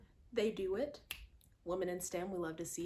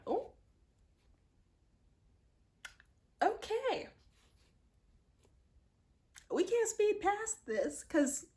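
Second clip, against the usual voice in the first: excited; 8 semitones higher, 4 dB louder than usual. Retold or imitated. Voicing her inner reaction.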